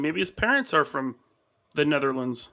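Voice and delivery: ordinary talk, nothing marked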